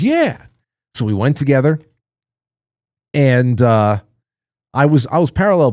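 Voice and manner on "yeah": much higher, bright eager yeah; child's high-pitched yeah; eager kid yes. Retold or imitated. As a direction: answer like an excited little boy. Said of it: "He goes".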